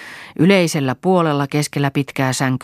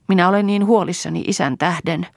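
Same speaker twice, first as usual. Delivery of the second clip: worried, higher-pitched voice; mildly gloomy, pitch raised; downhearted; 6 semitones higher than usual. A narrator's reading. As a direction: confide a worry, a touch dejected. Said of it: "sanoi Lea alakuloisena".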